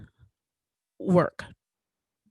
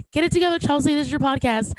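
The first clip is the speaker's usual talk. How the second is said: choked up; 7 semitones higher, faster than usual. Real, not acted. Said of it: "pardon me if I get emotional".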